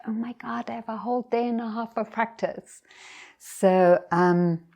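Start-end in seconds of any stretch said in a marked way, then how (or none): 0.1-2.8 higher, quickened mock amazement; imagined delight. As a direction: lightly act out happy disbelief. Imagined delight at extra practice time.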